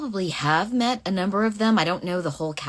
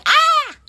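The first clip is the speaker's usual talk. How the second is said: sudden loud, very high "ah"; a short piercing cry; high scream; 10 dB louder than usual. Real, not acted. Sudden outburst mid-question on stream.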